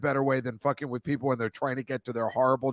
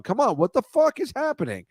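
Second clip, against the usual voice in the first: higher mock-indignant animal voice; camel voice; 7 semitones higher than usual. Host voicing a confused camel in India, mock outrage. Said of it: "this camel in India going".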